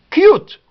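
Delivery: "Cute" high, loud, as kyoot; u as you. Shown as a demonstration. Teacher demonstrating U said you, punched glide. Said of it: "Ici, c'est you".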